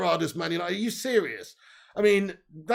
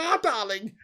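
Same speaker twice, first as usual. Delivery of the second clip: ordinary talk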